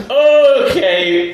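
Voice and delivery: high, loud, long-drawn "okay"; mock uneasy okay. Reacting to a friend growling at him, mock uneasy okay. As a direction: stretch it out while backing off, half laughing.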